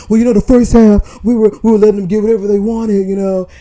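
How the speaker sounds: loud breathless panting, words pushed out; out of breath. Retold or imitated. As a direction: gasp it out like right after a game. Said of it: "he all out of breath".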